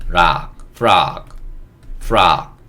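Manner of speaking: 락 alone, then 프락 with f blended on, loud and slow; blended pronunciation demo. Shown as a demonstration. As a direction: build up 프락 from 락, drawn out on "ah". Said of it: "푸 소리를 내야 되는데". (락에 F를 붙여 프락, 크고 길게 시범)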